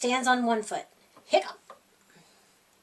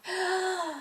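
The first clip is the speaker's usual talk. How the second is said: sharp gasping breath in; 5 semitones higher, 6 dB quieter than usual. Acted. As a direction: suck in a big breath. Pete takes deep breath.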